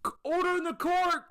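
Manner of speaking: a tiny judge squeaking up high, banging a gavel; high squeaky judge. Pretend. Mock judge calling the baby court to order, high and squeaky.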